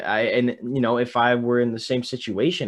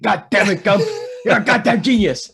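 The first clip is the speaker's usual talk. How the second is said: high, loud, fast hollering sergeant; drill sergeant yell; 9 semitones higher, 6 dB louder than usual. Retold or imitated. Drill sergeant hollers praise at Private Gump, high and loud. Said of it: "the drill sergeant goes".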